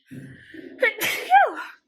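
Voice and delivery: real loud double cough or sneeze; sudden explosive cough mid-sentence; coughing fit. Real, not acted. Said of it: "excuse me".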